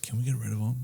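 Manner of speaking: very soft, low aside; hushed parents. Retold or imitated. Parents privately discussing their child, hushed imitation. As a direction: imitate parents conferring quietly. Voicing parents talking about him.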